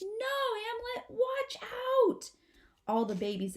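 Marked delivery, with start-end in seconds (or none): 0.0-2.3 put-on baby possum screaming to warn her brother; frightened scream. A narrator's reading. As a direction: scream a warning in terror. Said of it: "Apple Blossom screams".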